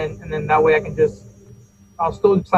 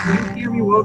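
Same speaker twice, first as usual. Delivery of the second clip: muffled voice with the highs cut off; 5 semitones higher than usual. Real, not acted. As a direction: voice dull and muffled, like through a wall. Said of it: "uh, muffled".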